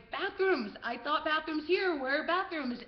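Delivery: high, loud simplified foreigner talk; clueless tourist. Retold or imitated. Lost tourist asking Serbian guards, clueless and loud.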